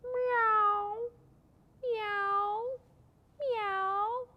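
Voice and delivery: high, drawn-out cat cry; long high meows. Shown as a demonstration. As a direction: imitate a little cat calling. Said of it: "Hear its funny sound".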